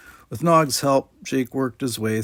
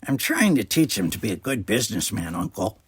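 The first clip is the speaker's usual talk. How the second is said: put-on nasal-free odd Ferengi kid voice; a scheming little alien; sly Ferengi boy. A narrator's reading. Nog suggests eating at his uncle's bar, a sly Ferengi kid voice.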